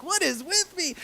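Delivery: high-pitched acted dismay; self-exasperation. Believer after doing the dumbest thing, exasperated with self. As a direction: wail it at yourself, hammed-up.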